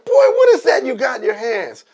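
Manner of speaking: loud, high demanding holler; imitated yelling old man. Old man questioning boy with bushel, mock yell. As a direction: holler it like a nosy neighbor. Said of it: "He's yelling at him".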